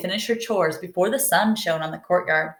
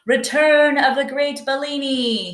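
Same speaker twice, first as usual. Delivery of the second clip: yelling; 7 semitones higher, 3 dB louder than usual. Acted. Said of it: "he was yelling".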